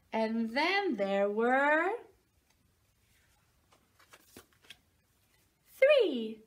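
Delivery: slow, sing-song picture book reading; reading to a toddler. Acted. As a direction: read it like bedtime for a little one. Reads picture book to children.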